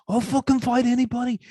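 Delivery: high, hammed-up Scouse fighter bragging; a thick Liverpool accent, overdone cocky boast; mock Liverpool brag. Host imitating a Liverpool fighter's mic boast, lightly Scouse and high. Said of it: "He's like".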